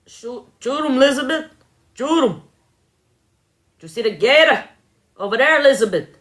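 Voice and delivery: put-on high-pitched swamp hunter accent; Swamp People accent. Mimicking a gator hunter calling to Elizabeth, Swamp People accent. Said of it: "then I could mimic it".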